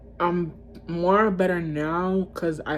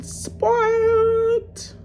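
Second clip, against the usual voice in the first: drawn-out announcement. Real, not acted. Draws out the ranking's last word.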